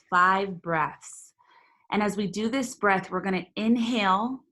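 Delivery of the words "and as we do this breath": normal talking voice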